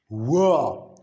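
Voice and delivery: W as "wuh", not the letter name; isolated "wuh" for W; voiced consonant demo. Shown as a demonstration. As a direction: sound out W with a short vowel.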